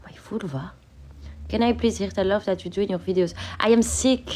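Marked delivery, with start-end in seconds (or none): 0.0-0.7 hushed, breathy whisper of "My vulva?". Real, not acted. Repeating a viewer's word to the chat, whispered. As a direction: whisper "My vulva?" as if it's secret.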